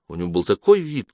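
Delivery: plain reading voice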